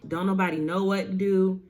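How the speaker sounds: usual speaking voice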